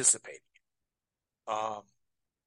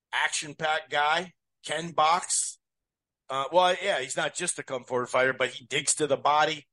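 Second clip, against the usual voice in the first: usual speaking voice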